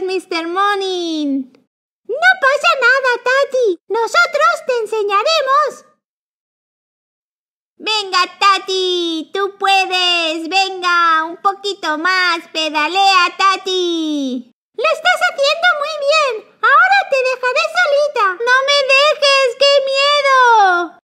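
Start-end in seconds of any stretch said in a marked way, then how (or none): 2.1-5.9 higher, quick friendly toy voice; reassuring high character. Acted. 14.7-18.2 much higher, fast sweet character voice; high encouraging voice. Acted. Helper lets go of bike. 18.5-21.0 higher, slow, whimpering plea; scared little girl. Acted. Tati scared on bike.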